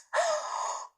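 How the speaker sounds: sharp frightened gasp; startled gasp. A narrator's reading. Startled by cracking noise.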